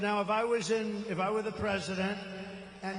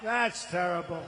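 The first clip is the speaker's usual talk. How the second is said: usual speaking voice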